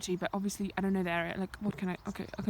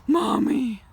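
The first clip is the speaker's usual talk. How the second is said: loud high cry for mommy; startled shout of mommy; scared yelp; 4 semitones higher, 14 dB louder than usual. Real, not acted. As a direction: yelp it in fright. Startled by animal, cries out.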